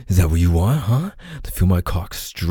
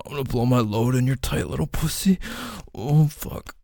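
moans climbing much higher as he peaks; climax moans; 10 semitones higher than usual. Acted. Lover reaching climax with his partner, moans rising much higher. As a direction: let the voice climb higher with each breath of release.

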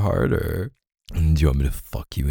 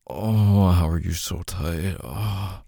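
ordinary delivery

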